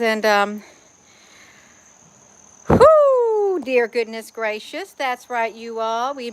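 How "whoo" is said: high, loud whoop of delight; sudden high whoop; excited whoo. Real, not acted. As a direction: let out a joyful whoo. Sudden exclamation outdoors.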